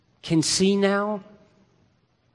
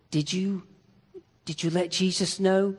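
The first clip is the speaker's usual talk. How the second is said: weak dying whisper; 7 dB quieter than usual. Acted. Dying Lazarus asks his sister.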